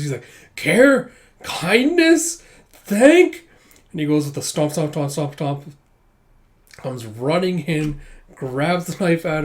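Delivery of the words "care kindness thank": very high, loud rising shrieks; appalled disbelief. Retold or imitated. Brother cannot stand her praising her husband, shrill disbelief. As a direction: echo each word as a separate appalled question. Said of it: "Yuri cannot stand this".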